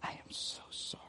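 hushed, breathy whisper of a tearful friend; retold whisper. Friend whispering sorry at the casket, hushed whisper. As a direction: whisper the apology as if leaning close.

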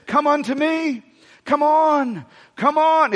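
put-on high-pitched British accent. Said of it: "put that British voice on there".

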